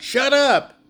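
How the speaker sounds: loud, high-pitched shout; yelled command. Retold or imitated. Teacher acting out Jesus rebuking the wind, yelled command. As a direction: yell it out to silence a storm. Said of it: "he yells out".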